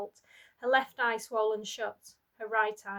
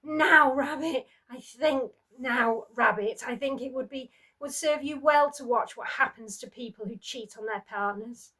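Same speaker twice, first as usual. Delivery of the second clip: menacing taunt; 3 semitones higher, 7 dB louder than usual. Acted. Husband taunting daughter.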